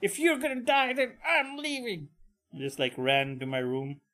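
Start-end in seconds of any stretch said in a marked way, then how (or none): 0.0-2.1 put-on high, upset child voice; upset kid. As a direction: huff it out like a fed-up kid. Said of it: "I was like".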